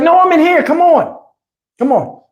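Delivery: high-pitched imitated woman; mimicking her high call. Imitating woman calling visitor in.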